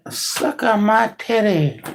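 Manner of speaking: high, slow mock cat voice; teasing the cat in a high put-on voice; voicing the cat. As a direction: give the cat a high, drawn-out voice. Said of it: "You're like".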